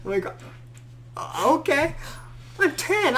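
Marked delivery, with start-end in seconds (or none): 1.2-3.2 high, incredulous squeak; a ten-year-old boy, flustered and high-pitched; flustered protest. Retold or imitated. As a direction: protest that you're just a kid. Boy protesting he can't do CPR.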